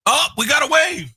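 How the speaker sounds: excited spotting. Real, not acted. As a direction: point it out, thrilled. Spots a viewer waving outside.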